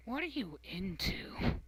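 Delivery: judging question. Retold or imitated. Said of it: "judge you and look at you and go".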